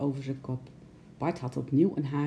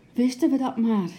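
high, loud sighed wish; heaved-out regret, pitched up; sighing; 8 semitones higher, 6 dB louder than usual. A narrator's reading. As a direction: say it on a helpless sigh. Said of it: "met een zucht".

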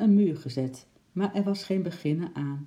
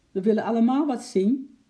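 loud, higher protest; calling out; 8 semitones higher, 6 dB louder than usual. A narrator's reading. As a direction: shout at someone pushing through the crowd. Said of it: "riep een slagersknecht".

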